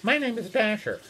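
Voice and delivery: a hint of talking reindeer; lightly voiced reindeer. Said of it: "these reindeer are getting onto the boat".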